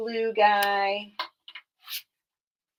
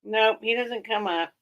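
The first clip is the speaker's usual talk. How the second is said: ordinary talk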